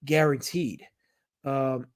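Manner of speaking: usual speaking voice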